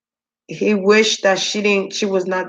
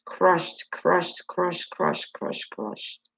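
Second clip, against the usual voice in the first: fading to a whisper; 8 dB quieter, much slower than usual. Acted. Medium channeling a crushed spirit, fading to a whisper. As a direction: repeat it softer each time until only breath is left. Medium repeating felt pain.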